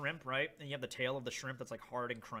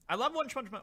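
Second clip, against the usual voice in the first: ordinary talk, nothing marked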